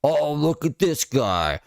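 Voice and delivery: high-pitched imitation of an indignant man; mock angry rant. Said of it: "He gets angrier".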